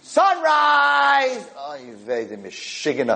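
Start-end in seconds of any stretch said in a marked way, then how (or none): 0.0-1.5 high, drawn-out mock shout; incredulous cry. Retelling a man's reaction to being woken at sunrise, incredulous cry. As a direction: cry it out in one long high note.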